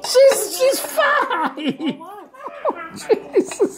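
high laughing through the words. Real, not acted. Laughing at toddler at table.

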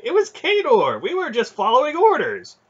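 high, loud panicked excuse; frightened soldier's urgent, high-pitched plea; hasty protest. A narrator's reading. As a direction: shift the blame in alarm. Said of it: "one of the soldiers said quickly".

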